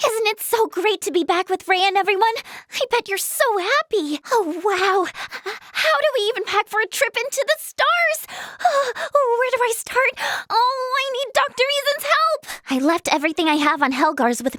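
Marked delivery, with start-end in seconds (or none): 0.1-4.2 high, delighted greeting; gushing delight. Acted. Welcoming a returned friend back, gushing with delight. 4.3-12.6 high, gasping and overwhelmed; flustered excitement. Acted. Wondering how to pack for the stars, flustered.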